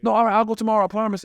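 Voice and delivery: put-on pleading kid. Kid begs older friend to come along, high pleading voice. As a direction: beg like a kid brother.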